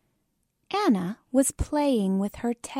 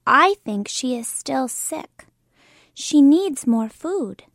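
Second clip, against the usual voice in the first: louder, self-important little doctor; pretend doctor; 3 semitones higher, 4 dB louder than usual. Kim as pretend doctor promising shots, louder and sure. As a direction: play the confident doctor.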